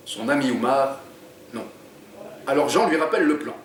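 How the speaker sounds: lively read-aloud narration; story reading. A narrator's reading. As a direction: read the chapter to draw listeners in. Author reads his book aloud.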